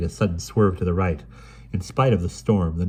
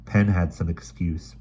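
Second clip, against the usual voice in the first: usual speaking voice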